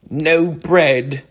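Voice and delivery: high, groaning complaint. A narrator's reading. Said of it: "groaned the mole".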